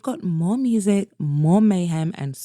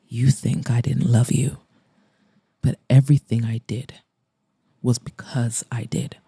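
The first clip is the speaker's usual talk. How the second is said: Mason, softening; 7 semitones lower than usual. Acted. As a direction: lower male voice, gentle confession fading almost to a whisper. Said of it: "his expression softening".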